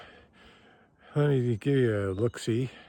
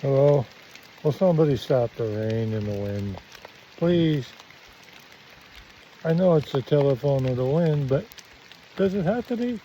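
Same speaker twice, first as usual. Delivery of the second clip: shouting over wind; 3 semitones higher, 6 dB louder than usual. Real, not acted.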